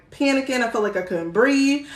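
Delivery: usual speaking voice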